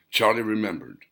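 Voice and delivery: plain reading voice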